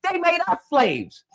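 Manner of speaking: normal talking voice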